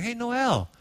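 softening, gently questioning; sit a child down and ask tenderly, voice sinking; retold gentle father. Father gently asking his upset daughter, softening voice. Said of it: "sat her down and was like".

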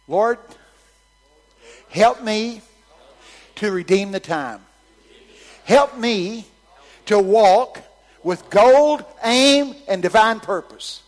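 loud preaching cry; preaching at full force. Acted. Congregation repeats after preacher.

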